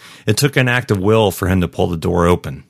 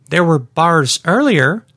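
slow, high-pitched complaint; indignant shopper; 10 semitones higher, slower than usual. Acted. Trapped shopper finding no phone signal, indignant. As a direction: object slowly in a higher voice.

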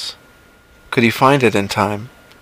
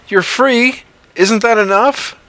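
much higher, incredulous question; John's voice; 12 semitones higher than usual. Acted. John challenges the creature, high and incredulous. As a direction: push back in disbelief.

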